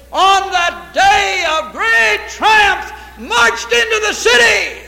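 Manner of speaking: full-force revival shout, slowing on the big words; shouting preacher climax. Acted. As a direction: lift the church to the triumphal entry. Climax on triumphal entry.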